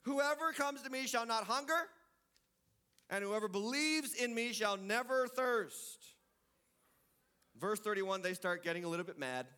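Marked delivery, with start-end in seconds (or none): 0.0-6.1 raised, ringing proclamation; preached scripture; emphatic scripture reading. Acted. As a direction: lift each promise up. Reads Jesus' words.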